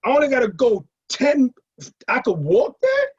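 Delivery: high, loud amazed what, slow at the end; shocked and thrilled disbelief. Retold or imitated. Hearing from sister Red that the party is in the Bronx, high loud amazement. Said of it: "I'm like".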